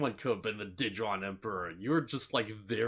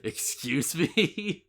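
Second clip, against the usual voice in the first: slow, drawn-out baffled question; stretched-out incredulous reaction; mock disbelief; 3 semitones higher, much slower than usual. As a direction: play a bystander who can't believe it. Joins in as baffled normal person.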